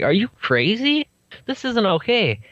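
appalled at her joking, pitch way up; high incredulous scolding; shocked disbelief. Retold or imitated. Shocked at the overdose joke.

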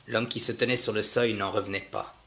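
ordinary delivery

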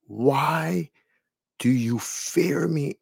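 slow, gentle, tender divine voice; a slow, soft, loving question; soft voice of Jesus. Retold or imitated. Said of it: "this soft, gentle voice".

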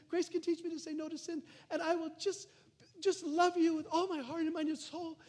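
a light mock-earnest prayer voice; imitating his younger self begging God; naive pleading prayer. Preacher mimicking his old naive prayers, mildly pleading. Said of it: "I used to think spirituality was".

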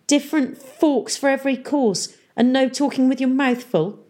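high-pitched mocking voice; sneering. A narrator's reading. Ames mocking Tom's lessons in manners, high sneer. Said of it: "sneered Ames".

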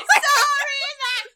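very high, loud mock-screamed lyric; imitated screaming. Host mimics the singer over and over, mock screaming. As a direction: scream it like an overwrought singer, half laughing. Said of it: "like, he's just screaming it".

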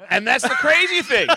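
high, loud shout over laughter; real worked-up yell; excited exclamation. Real, not acted. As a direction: burst out to win back the room.

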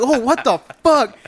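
high, drawn-out disbelief; startled outburst. Retold or imitated. Storyteller reacting to Tim bursting in, startled and high. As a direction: exclaim it high, rising at the end. Retelling shocked reaction to intruder.

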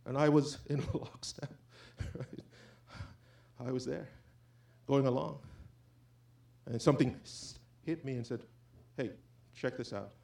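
soft, inward, almost to himself; quiet confession. Real, not acted. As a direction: drop the voice to a confiding hush. Drops to confessional hush.

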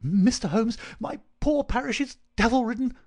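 high, loud, agitated vicar in bursts; frantic gasping cry. A narrator's reading. Frightened vicar bursts in on Holmes, frantic. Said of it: "in gasps and bursts".

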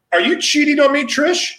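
high, teasing mock accusation; mock jealous. Joking jealousy at cohost.

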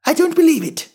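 very high, loud bird voice; high-pitched pigeon disbelief; disbelieving pigeon. A narrator's reading. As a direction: reject it in a high squawk. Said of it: "said the pigeon".

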